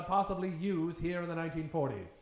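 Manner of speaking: ordinary talk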